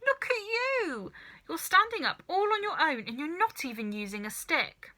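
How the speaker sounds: put-on boy amazed his grandmother stands alone. A narrator's reading.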